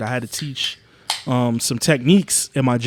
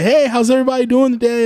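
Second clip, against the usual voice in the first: higher, bright mock work-meeting voice; fake cheerful greeting; 10 semitones higher, 4 dB louder than usual. Greeting coworkers on a call, high chirpy mock politeness. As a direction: put on your polite meeting voice.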